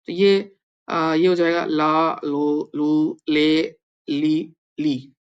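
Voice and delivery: ला, लो, लू, ले, ली sounded out slowly; slow syllable drill. Shown as a demonstration. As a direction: sound out ला लो लू ले ली one by one. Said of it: "ल ला सकते हैं". (धीरे-धीरे ला लो लू ले ली)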